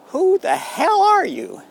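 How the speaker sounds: hammed-up bark of who are you; outraged demand. The dictator confronting the stranger, hammed-up outrage.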